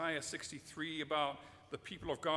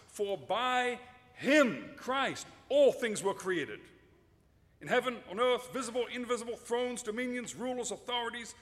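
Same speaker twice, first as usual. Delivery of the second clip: emphatic scripture reading; 3 semitones higher, 6 dB louder than usual. Acted. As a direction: declaim each phrase like a herald. Reads Paul's words to Colossians.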